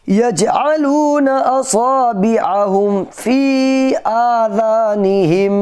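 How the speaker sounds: melodic tajweed chant; Quran recitation. Shown as a demonstration. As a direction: recite slowly, stopping at Barq. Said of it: "यहाँ इस तरीके से बोलेंगे".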